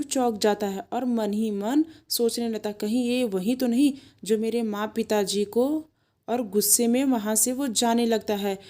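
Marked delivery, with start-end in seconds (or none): none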